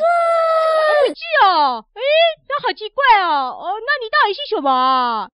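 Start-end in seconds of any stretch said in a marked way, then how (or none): 0.0-1.8 高声喊出的吃惊"哦"; 像发现大事一样高声惊呼; 夸张的惊讶. Pretend. 听到不是，大侠惊讶. (夸张地高声惊呼)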